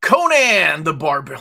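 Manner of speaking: calls out the title loud, stressing Conan; loud announcement. Real, not acted. Showing off a comic to friends, loud announcement. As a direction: proclaim the title with a big push on Conan.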